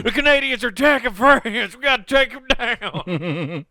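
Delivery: high, loud mock patriot shout; hammed-up alarm. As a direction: sound the alarm like an over-the-top American. Acted American alarm at terrorists.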